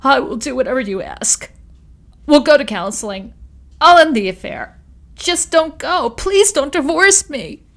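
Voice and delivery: high, loud desperate pleading; begging. A narrator's reading. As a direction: plead on your knees.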